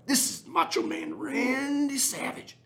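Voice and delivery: very high, slow, squeaky wrestler parody; Macho Man Randy Savage. Retold or imitated. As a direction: stretch it out in a ridiculously high falsetto. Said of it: "That's terrible".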